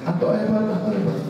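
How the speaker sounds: high repeated call, a touch fainter; echo. Acted. The hill echoes the father's praise. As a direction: echo it back twice.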